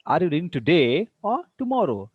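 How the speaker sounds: stretched rise on "today", fall on "tomorrow"; deliberate demo of "today" climbing, "tomorrow" falling. Shown as a demonstration. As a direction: spell out the choice, "today" up and "tomorrow" down. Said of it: "Today, rising intonation".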